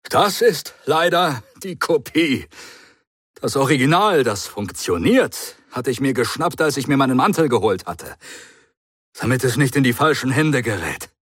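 a touch of resignation, still high and quick; resigned Doctor. Acted. The Doctor resignedly explains the copy to Matthews. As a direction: admit defeat with a sigh in the voice. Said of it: "resignierte der Doktor".

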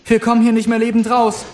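calling over the noise. A narrator's reading. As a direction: raise your voice over the roar. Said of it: "Stimme über die der Lawine zu heben".

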